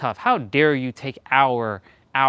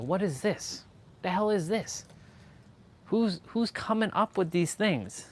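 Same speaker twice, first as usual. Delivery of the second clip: high, softer mock bewilderment; baffled disbelief; 6 semitones higher, 7 dB quieter than usual. Reacting to unfamiliar slang, baffled.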